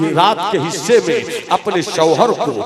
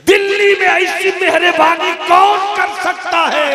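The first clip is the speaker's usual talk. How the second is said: very high, loud exclamation; amazed cry; 14 semitones higher, 8 dB louder than usual. Acted. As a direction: cry it out in wonder, high and sustained. Woman marvels at the gift. (हैरत में ऊँची पुकार)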